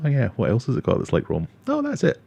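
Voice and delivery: normal talking voice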